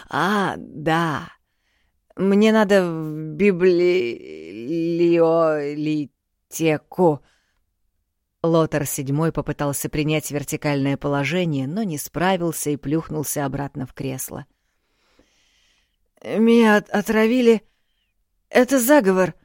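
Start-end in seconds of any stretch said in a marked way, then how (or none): 0.1-7.2 put-on very slow, drawn-out drunk words. A narrator's reading. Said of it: "король размяк". 16.2-19.3 put-on drunken alarm. A narrator's reading. Drunk king fears poison.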